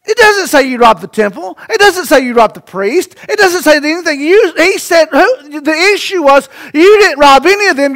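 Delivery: normal talking voice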